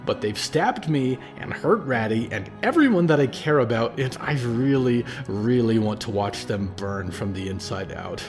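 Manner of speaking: put-on a furious young man venting his pain; rising anger. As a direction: let the rage boil over, then grind it out. Percy rages at the cult.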